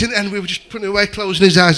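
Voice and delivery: ordinary talk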